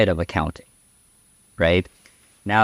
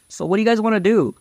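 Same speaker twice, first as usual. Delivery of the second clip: quick, higher-pitched mock question; higher quick quoted colleague; 10 semitones higher, much faster than usual. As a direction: toss it off fast and high. Quoting colleague in meeting.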